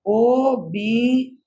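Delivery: slow board-writing letters; spelled out slowly. Shown as a demonstration. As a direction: drag each letter as you write. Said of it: "major sector का नाम लिख रहा हूँ".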